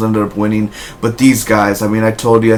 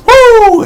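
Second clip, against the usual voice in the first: a high, loud Ric Flair woo; high woo yell; 7 dB louder than usual. Retold or imitated. Imitating Charlotte's woo cry to the crowd, loud and high. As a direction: belt out a shrill woo. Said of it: "you're like".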